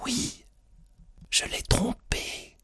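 hushed whisper; murmured confession. A narrator's reading. Wife whispering a confidence in her husband's ear, murmured. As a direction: whisper it as a secret. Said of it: "elle murmura".

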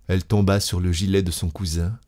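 ordinary delivery

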